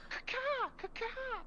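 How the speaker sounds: loud, high squawking bird call; chicken caw. Retold or imitated. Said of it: "[name]".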